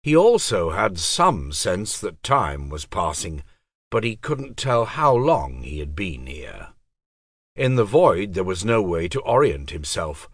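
ordinary delivery

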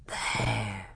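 put-on low, soft voice of an old scholar; a wise old doctor murmuring in awe; hushed old tutor. A narrator's reading.

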